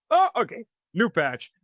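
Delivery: a sudden jump up high, hammed-up oh okay; mock surprise. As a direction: play the soldier who just noticed the side changed. Italians mocked switching sides.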